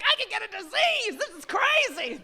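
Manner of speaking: high, loud comic alarm; mock panic. Comic acting out herself during sex, mock panic. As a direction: wail it in hammed-up panic.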